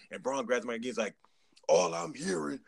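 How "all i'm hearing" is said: higher-pitched mock wrestler on the mic; imitating raised voice. Braun grabs the mic to answer the taunt, imitated raised voice. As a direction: mildly mimic someone raising their voice. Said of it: "and he's raising his voice".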